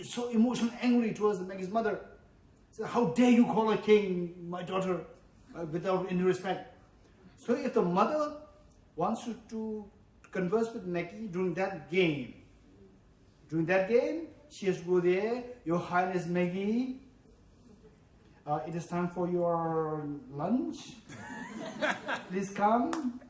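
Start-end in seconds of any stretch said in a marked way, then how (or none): 2.8-5.1 indignant, mildly angry rebuke; mock angry. Angry players scolding Maggie's mother, mock indignant. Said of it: "everybody becomes so angry". 15.6-23.2 slow, deferential invitation; nice and respectful. Acted. Mother humbly calling her king daughter to lunch, nicely and respectfully. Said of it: "You have to speak nicely".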